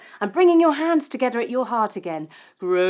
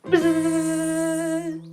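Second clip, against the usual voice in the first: sung buzz; 4 semitones higher than usual. Pretend. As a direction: sing the buzz. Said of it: "jumping into the third flower".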